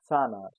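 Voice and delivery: Zahnarzt said "TSAHN-arts"; German pronunciation. Shown as a demonstration. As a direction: hiss the Z of Zahnarzt. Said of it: "sounds as S".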